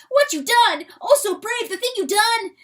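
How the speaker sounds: very high, loud, wondering little weasel; excited weasel voice. Acted. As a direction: squeal it out in awe as the small weasel. Weasel praises rooster.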